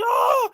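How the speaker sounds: very high mock scream; quick high-pitched yelp, imitating pain; mimicked scream without full force; imitated scream. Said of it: "he's screaming".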